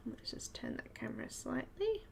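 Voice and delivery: murmured aside while fiddling; whispered aside. Real, not acted. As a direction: whisper it off to the side. Aside while adjusting camera.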